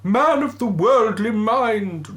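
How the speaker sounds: high, loud, slow spectral voice; ghostly wail. Acted. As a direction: intone it like a phantom. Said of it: "replied the ghost".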